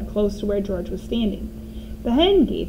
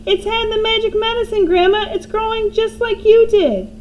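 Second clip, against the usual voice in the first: high, loud thrilled shout; boy shouting excitedly; 12 semitones higher, 5 dB louder than usual. A narrator's reading. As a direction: hop with excitement as you yell. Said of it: "pointing at the enormous hen and shouting".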